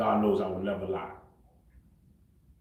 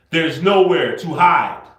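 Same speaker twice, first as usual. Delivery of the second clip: slow, loud, emphatic and higher-pitched warning; 5 semitones higher, 10 dB louder, slower than usual. Real, not acted. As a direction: spell it out forcefully, as if to someone who won't listen. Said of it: "I'm gonna say this very slow for you".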